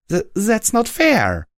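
a helpless furious squeak; squeaked in rage. A narrator's reading. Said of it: "I squeaked in impotent rage".

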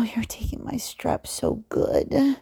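normal talking voice

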